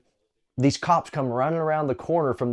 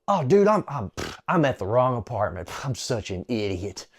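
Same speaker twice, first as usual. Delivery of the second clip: imitating a fumbling, caught-out excuse; acting flustered. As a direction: play the guilty guy stammering an excuse. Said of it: "he kinda acts strange".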